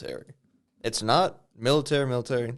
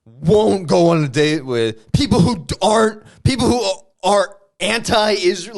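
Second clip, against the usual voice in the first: high, loud mock indignation; mock indignant dater; 9 semitones higher, 7 dB louder than usual. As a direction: declare your dating rule with outrage. Mock Tinder profile rant.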